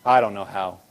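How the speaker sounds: very low, defeated mumble; mock the overwhelmed doubter, voice dropped right down; low, hopeless put-on voice; imitated helpless worrier. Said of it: "you're sitting there going".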